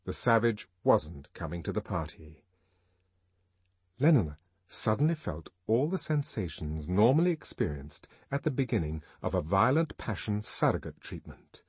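ordinary delivery, nothing marked

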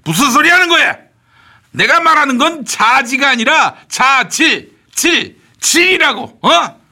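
exasperated shout. Acted. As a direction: drag out 질 to correct her. Correcting the misheard word loudly. (답답해서 높게 외치며 바로잡음)

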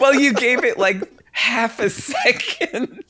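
high, slow laughing tease. Real, not acted. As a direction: giggle out the teasing.

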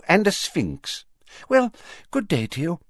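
high, fussy official voice; Fudge voice. Acted. Fudge answering the Prime Minister in a high fussy voice. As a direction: play him as a flustered minister.

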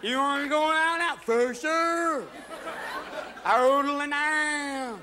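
put-on slow, high drunken drawl; slurred drunk. Two drunks planning to go out, slow high slur. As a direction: stretch it like you can barely talk.

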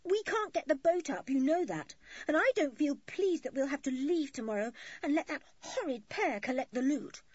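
high-pitched indignant grumbling; cross complaint. A narrator's reading. George resents leaving the loot behind, cross.

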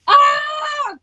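loud frustrated scream; frustrated yell. Real, not acted. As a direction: let out a sharp yell. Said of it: "I need to stop yelling like that".

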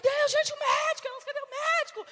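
high, urgent calling for the doctor; panicked call. Retold or imitated. The girls cry out for the missing doctor, high and panicked. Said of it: "as meninas".

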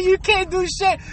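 ordinary talk, nothing marked

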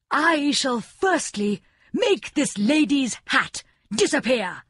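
slow, high proclaiming king; king crying out. A narrator's reading. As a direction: announce it grandly to the crowd. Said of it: "cried the king".

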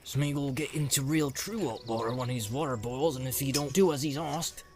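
understated growled threat; lightly growled hobbit warning; mild growl. Acted. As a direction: warn gruffly, without raising the voice. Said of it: "growled Sam".

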